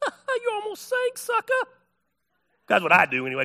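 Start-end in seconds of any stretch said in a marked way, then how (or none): 0.0-1.7 very high teasing squeal; making fun. Acted. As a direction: rib a friend in a high, drawn-out mocking voice. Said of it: "I'm gonna make fun of him".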